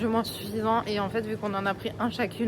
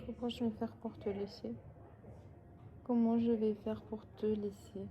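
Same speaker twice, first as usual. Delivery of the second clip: much softer, wistful; soft sad lament; 9 dB quieter than usual. Real, not acted. To the cat before leaving, soft wistful lament. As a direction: sigh over leaving it.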